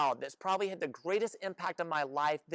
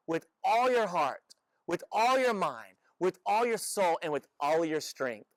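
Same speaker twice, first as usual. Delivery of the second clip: louder, slow, stress on each "all"; emphatic scripture; 4 dB louder, slower than usual. Acted. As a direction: hit every "all" like a preacher. Jesus quoting the greatest commandment.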